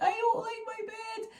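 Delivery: put-on high, slow little-girl complaint; toddler whine. Toddler grumbling to mum about her bed, in a high slow whine. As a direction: sulk it like a small child.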